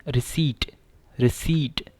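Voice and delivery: रिसीट with no p sound, ri-seet; silent p. Shown as a demonstration. Teacher models रिसीट for students, p silent. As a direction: say रिसीट cleanly, dropping the p. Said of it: "पी क्या है, silent है".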